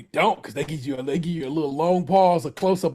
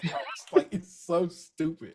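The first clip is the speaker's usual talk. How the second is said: usual speaking voice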